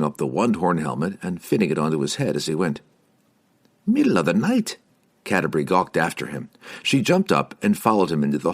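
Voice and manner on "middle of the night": high startled cry; shocked, rising exclamation; surprised exclaim. Acted. Exclaiming at sudden rush.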